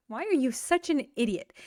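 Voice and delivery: usual speaking voice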